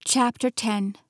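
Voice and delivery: usual speaking voice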